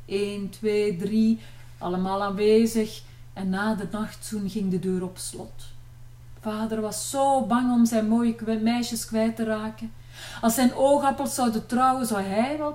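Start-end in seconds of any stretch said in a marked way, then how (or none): none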